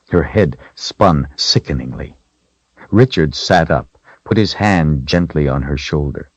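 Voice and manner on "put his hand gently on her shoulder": usual speaking voice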